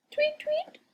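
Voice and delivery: very high chirping tweet; bird chirp. A narrator's reading. Birds chirp beside her.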